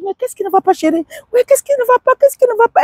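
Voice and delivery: high, anxious wife voice asking what's wrong; voicing the panicked wife, high and urgent; worried wife.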